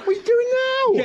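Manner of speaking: sudden high alarmed question. Real, not acted. Bailiff startled as the owner grabs at him, alarmed cry. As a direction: caught off guard mid-scuffle.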